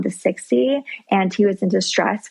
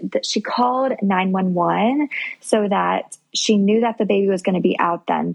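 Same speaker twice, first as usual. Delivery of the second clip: usual speaking voice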